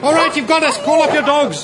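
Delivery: high-pitched plea; alarmed surrender. Acted. Captain caught by pursuer's dogs, alarmed surrender. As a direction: give in quickly, voice pitched right up.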